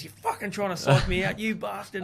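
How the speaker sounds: imitating an irate rival; angry accusation. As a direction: hammer them with a mock-furious accusation. Retold rival hammering him angrily.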